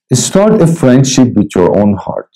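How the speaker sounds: slow, deliberate reading from his book; devotional reading. Acted. Preacher reads his devotional book to listeners, slow and measured. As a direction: read each line slowly and let it land.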